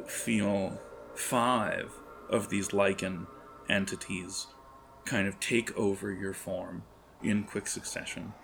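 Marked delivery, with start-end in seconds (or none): none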